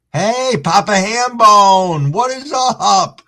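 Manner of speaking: high, slow, drawn-out hello; excited greeting. Real, not acted. Streamer greets a newly arrived chatter, excited and drawn out. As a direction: call out to a friend arriving. Greeting a viewer in live chat.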